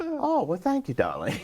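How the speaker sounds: high, swooping diva impression; Zsa Zsa Gabor. Celebrity passenger thanking flight attendant, high imitated diva voice. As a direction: mimic a grand dame's thanks. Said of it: "and she went".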